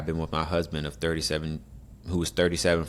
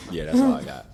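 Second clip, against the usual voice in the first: laughing; 5 dB louder than usual. Real, not acted. After a big laugh, high.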